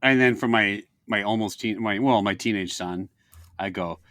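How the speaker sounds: ordinary talk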